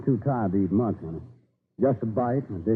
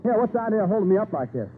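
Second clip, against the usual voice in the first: fast, higher, impatient protest; impatient hurry; 5 semitones higher, faster than usual. Acted. Rider stopped on the road complains fast and impatient. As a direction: rattle it off like a man in a rush.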